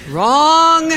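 high, loud game-show "Wrong."; mock buzzer verdict. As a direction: call it out like a quiz-show buzzer. Comic buzzer-style verdict.

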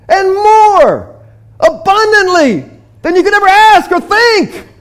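overjoyed shouting; joyful shout. Acted. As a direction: yell it to the whole city. Men shouting news.

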